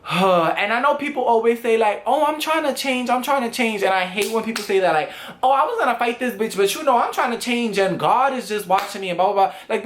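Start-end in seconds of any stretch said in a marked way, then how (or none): 2.1-3.8 higher, sing-song mocking of excuse-makers; making fun of people who hide behind growth, pitched up; mock whiny excuse. Mocking what people always say. 5.4-9.5 quick, higher sarcastic parody; sneering at people who claim God stopped them; mock pious excuse.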